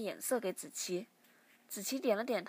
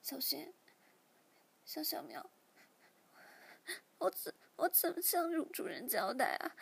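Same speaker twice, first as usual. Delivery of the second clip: soft, tearful murmur; 5 semitones higher than usual. A narrator's reading. Xiaomiao whispers tearfully to her kittens, soft and sad. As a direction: murmur it to the kittens, close to crying. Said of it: "小喵细声对小猫说".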